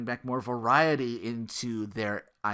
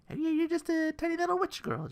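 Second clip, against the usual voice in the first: put-on much higher, sweet little girl voice; cutesy witch girl. Describing the game's tiny little witch heroine, high cutesy voice.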